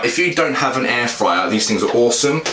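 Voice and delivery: ordinary delivery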